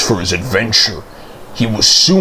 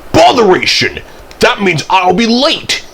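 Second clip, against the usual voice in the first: high, loud flare of annoyance; annoyed outburst; 9 semitones higher, 3 dB louder than usual. A narrator's reading. Thomas fears he'll be late, high exasperated outburst. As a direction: exclaim in exasperation, pitch jumping up.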